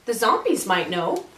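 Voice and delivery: ordinary delivery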